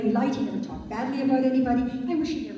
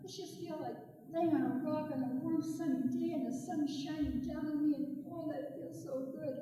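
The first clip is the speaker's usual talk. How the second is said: contented snake; 4 semitones higher, 9 dB quieter than usual. Acted. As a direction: savour the warmth, softly. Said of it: "He thought".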